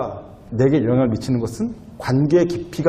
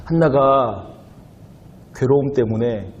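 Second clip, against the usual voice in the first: usual speaking voice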